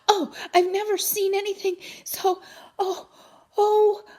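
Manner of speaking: high, loud awed gasps; overwhelmed. A narrator's reading. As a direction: gasp in breathless wonder. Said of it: "her hands were shaking".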